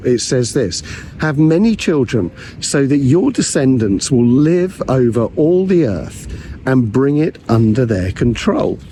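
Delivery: usual speaking voice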